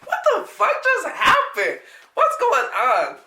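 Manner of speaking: high, loud, laughing through the words; giddy laughing confusion, then slowing down; laughing disbelief. Retold or imitated. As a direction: crack up while asking what just happened. Laughing disbelief after being dragged off.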